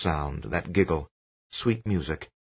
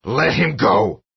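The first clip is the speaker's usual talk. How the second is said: slow, loud, fierce order; fierce command; 4 semitones higher, 5 dB louder, slower than usual. A narrator's reading. Father ordering the spirit out, fierce command. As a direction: command the spirit to release him. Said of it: "Jack said fiercely".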